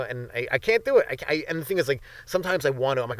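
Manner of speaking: ordinary delivery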